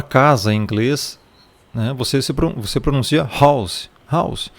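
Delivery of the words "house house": "house" pronounced /haws/, not letter by letter; correct English "house". Shown as a demonstration. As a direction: say "house" as one syllable, the English way. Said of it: "a pronúncia real ... você lê como se pronuncia".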